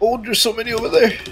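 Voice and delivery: alarmed high fast call; excited warning shout; man down shout. Real, not acted. Enemies swarming, alarmed.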